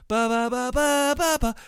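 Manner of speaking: sung staccato synth riff on "ba"; imitated synthesizer. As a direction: mimic a keyboard line with the mouth. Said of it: "doing the, the synthesizer thing".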